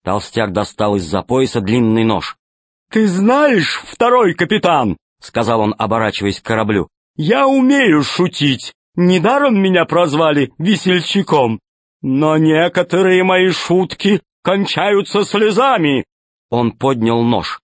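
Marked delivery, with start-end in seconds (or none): 2.9-5.0 high, slow jolly villain; fat pirate voice. Acted. Tolstyak turns to ship. 7.1-16.0 high, slow jolly villain; fat pirate voice. Acted. Tolstyak jokes menacingly.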